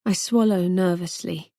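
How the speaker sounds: usual speaking voice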